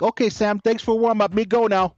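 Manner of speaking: imitating the Nigerian runner. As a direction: toss it off breezily in the runner's voice.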